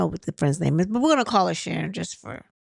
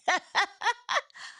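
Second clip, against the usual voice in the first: high laugh; 12 semitones higher than usual. Real, not acted. Laughs at the pink joke.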